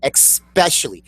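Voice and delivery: especially as high, split EX-SPESH-ully; Myrna's especially. Retold or imitated. Co-host guessing another's word, high EX-SPESH-ully for especially. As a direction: put an X in especially. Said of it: "probably says".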